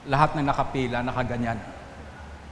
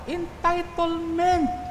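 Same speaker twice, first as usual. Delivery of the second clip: very high, slow exclaiming; high exclamation; much slower than usual. Acted. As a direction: stretch each word up high to brand it. Preacher exclaims the sermon's point. (mataas at mabagal na bulalas)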